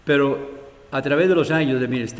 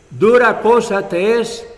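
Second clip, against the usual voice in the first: higher, louder, slow stern warning; emphatic rebuke; 6 semitones higher, 5 dB louder, slower than usual. Acted. The Lord rebuking Saulo, slow and emphatic. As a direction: lean hard on each word. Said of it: "Y por eso le dice".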